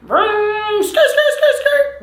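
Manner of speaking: high mock engine vroom and skidding skirt; car revving and tire screech. Telling how boyfriend sped to hospital, mock car noises.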